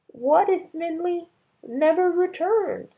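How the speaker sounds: high, slow tearful mother's voice; grieving wife. A narrator's reading. Ma to Ba by the empty bed, high and tearful. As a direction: plead it out through tears. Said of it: "Ma said".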